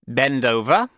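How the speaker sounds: forceful schoolmaster's order; stern headmaster. A narrator's reading. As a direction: command it, rising at the end. Headmaster orders boy to bend.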